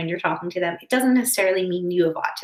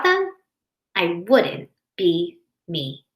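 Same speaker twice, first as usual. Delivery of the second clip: put-on gentle storybook narration for kids; reading to children. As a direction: read it aloud like to a classroom. Reads picture book to class.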